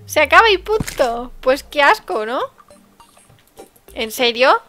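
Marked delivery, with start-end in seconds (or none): 0.0-1.3 loud, outraged outburst; indignant exclamation. Real, not acted. Streamer complaining to viewers, indignant loud outburst. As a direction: protest it's so unfair.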